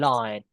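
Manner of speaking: "Line" dropped low; falling final word. Shown as a demonstration. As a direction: let "Line" slide down in pitch. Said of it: "go down". (nada "Line" turun rendah)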